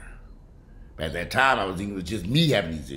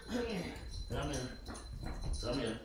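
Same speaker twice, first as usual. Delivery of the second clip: coaxing dog; 7 semitones higher, 9 dB quieter than usual. Real, not acted. Calling sick dog over.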